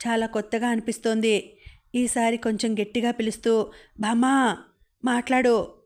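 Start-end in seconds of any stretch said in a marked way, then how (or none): 4.0-5.7 mild shout. A narrator's reading. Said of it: "అంటూ అరిచింది".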